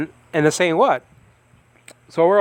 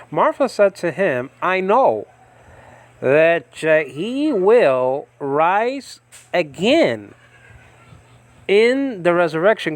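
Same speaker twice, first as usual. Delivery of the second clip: slow, raised reading of the verse; a preacher reading Martha's words aloud, higher and slowed; scripture reading; 4 semitones higher, 3 dB louder, slower than usual. Acted. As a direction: read the Bible line out with weight.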